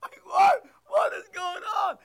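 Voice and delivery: high, loud cry of confusion; baffled outburst. Retold or imitated. Preacher acting out the confused target, loud and high. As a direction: shout it like you have no clue.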